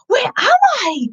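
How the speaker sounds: high squeaky wondering voice; Ted amazed. Acted. Ted gazing in amazement at the books.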